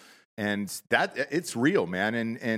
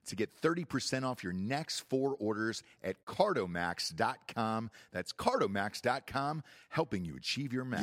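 softer, steady ad read; 6 dB quieter than usual. Acted. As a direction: read the sponsor spot smoothly. Ad read in quieter voice.

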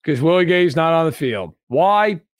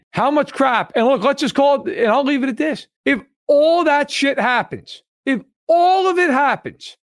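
rising frustration; 9 semitones higher than usual. Real, not acted. Heated rant climbing in pitch.

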